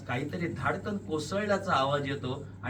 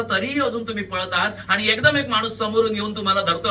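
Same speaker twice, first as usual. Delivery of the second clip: loud, higher, driving storytelling; urgent, panicked narration; 5 semitones higher, 7 dB louder than usual. A narrator's reading. Narrator as you flee the corpse, urgent and loud. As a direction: tell it as a headlong escape. Breathless flight from the murder scene.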